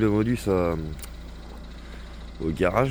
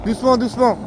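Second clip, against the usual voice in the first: calling out; 11 semitones higher than usual. Real, not acted. Calling out to the driver.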